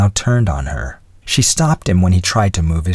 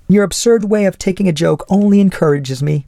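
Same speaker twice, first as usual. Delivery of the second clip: put-on much higher, taunting young lady; insolent; 11 semitones higher than usual. A narrator's reading. Young lady undeterred by his coldness, high insolent voice. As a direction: taunt him with cheeky insolence. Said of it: "she became more insolent than ever".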